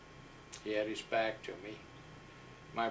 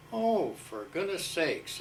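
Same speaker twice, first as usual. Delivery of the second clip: imitating the sergeant; 7 semitones higher, 4 dB louder, slower than usual. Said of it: "a very gravel type voice".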